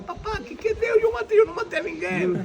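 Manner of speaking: put-on very high childlike plea to his father; child crying to dad. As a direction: squeak it out like a frightened little boy. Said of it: "Y yo decía". (niño asustado suplicando a su papá con voz muy aguda)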